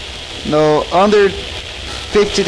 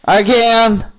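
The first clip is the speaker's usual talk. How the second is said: sharp raised-voice summons; calling out urgently; 5 semitones higher, 4 dB louder, slower than usual. Acted. Link calling Tetra back to him, urgent shout. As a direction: shout the orders after someone getting away.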